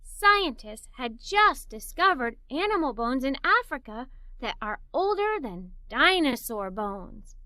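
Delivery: ordinary delivery, nothing marked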